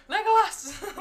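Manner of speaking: Legolas! called high, loud and slow; hammed-up epic cry of Legolas; dramatic call. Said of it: "What do your elf eyes say?".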